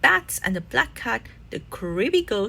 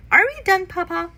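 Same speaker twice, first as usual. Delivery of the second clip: little sister voice; 8 semitones higher, 5 dB louder than usual. A narrator's reading. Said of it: "asked Sister".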